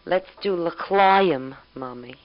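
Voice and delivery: put-on slow, loud child's toast voice; drawn-out toddler toast, stretching l'chaim; toddler's stretched-out toast. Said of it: "and she'll say".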